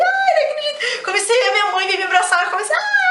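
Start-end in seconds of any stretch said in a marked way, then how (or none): 0.0-1.0 overjoyed disbelief. Retold or imitated. Passing the driving test, overjoyed. 2.4-3.0 retold joyful scream. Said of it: "Comecei a gritar igual uma louca".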